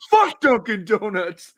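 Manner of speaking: mock fan rant. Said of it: "It's like".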